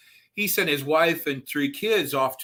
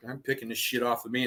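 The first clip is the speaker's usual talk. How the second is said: ordinary talk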